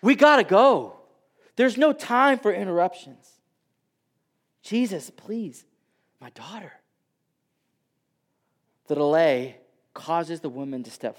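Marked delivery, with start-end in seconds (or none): 0.0-6.7 urgent pleading. Retold or imitated. Jairus urging Jesus to hurry to his dying daughter, urgent. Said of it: "imagine him looking at Jesus saying".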